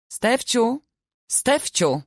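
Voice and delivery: mother calling her daughter from the house. A narrator's reading.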